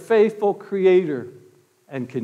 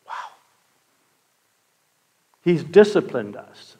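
ordinary talk

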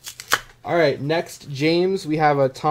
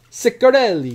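higher, louder hammed-up Italian accent; mock Italian accent; 7 semitones higher, 5 dB louder than usual. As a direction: punch the name in a playful Italian accent. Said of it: "I don't know why he's Italian".

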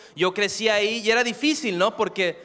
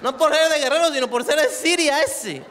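mock-Arabic coastal accent; 6 semitones higher than usual. Man from Guerrero barred from the States, mock-Arabic coastal accent. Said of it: "Se vuelve como árabe".

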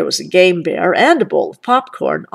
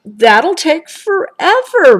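slow, higher, louder whine; moaning; 9 semitones higher, 6 dB louder than usual. A narrator's reading. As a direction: drag it out like a groan. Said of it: "moaned Brother".

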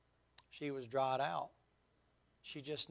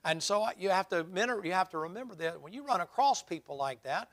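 loud, emphatic sermon delivery with raised pitch; forceful preaching; 2 semitones higher, 10 dB louder than usual. Real, not acted. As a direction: drive the point home to the congregation.

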